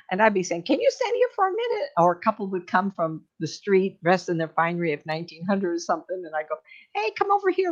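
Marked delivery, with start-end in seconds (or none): 0.6-1.9 high call. Retold or imitated. [name] voicing herself to spirit. 6.9-7.7 high call. Retold or imitated. Inviting spirit couple over.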